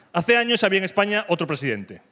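ordinary talk

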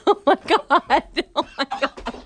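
laughing through the words; laughing hard. Real, not acted. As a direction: breaks up laughing. Watching friends suffer spicy sushi.

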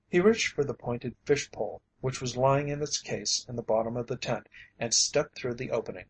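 usual speaking voice

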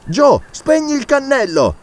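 high, slow, firm order; urgent command. Acted. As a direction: bark the order to the servant. Doctor gives urgent order. (il dottore ordina a Joe di spegnere, deciso)

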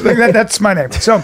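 normal talking voice

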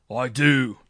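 loud, defiant boom; thundering. A narrator's reading. Marshal defies his judge in court, thundering. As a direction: thunder it out with full authority. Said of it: "thundered the marshal".

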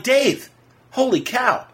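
high, slow, awestruck; stunned delight. Retold or imitated. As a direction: gasp it out like you can't believe who's there. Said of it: "And I'm like".

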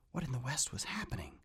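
hushed, puzzled whisper; whispered thought. A narrator's reading. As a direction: whisper it to yourself. John's inner puzzled thought.

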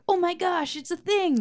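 excited realization. Retold or imitated. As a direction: recreate the thrilled moment the song clicked. Retold excited band discovery.

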